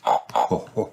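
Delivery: low "ho ho" chuckle; deep, brief character laugh; jolly chuckle. A narrator's reading. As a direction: chuckle low as an indulgent daddy. Daddy Pig chuckles before replying.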